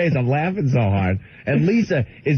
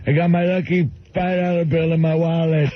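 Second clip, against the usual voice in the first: Bill; 3 semitones higher than usual. Retold or imitated. Bill boasting about teeth and lucky bill, simple-man caricature. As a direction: boast earnestly in a simple old man's voice. Quoting Bill's catchphrase again.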